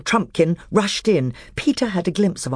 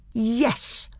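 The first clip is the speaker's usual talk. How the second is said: slowly and distinctly; 9 semitones higher, 4 dB quieter than usual. Acted. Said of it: "very slowly and distinctly".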